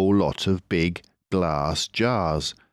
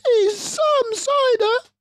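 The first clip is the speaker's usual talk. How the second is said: very high, loud delighted voice; marvel, a bit tipsy; giddy cub; 5 dB louder, slower than usual. Acted. Smallest fox marvels at the strong cider.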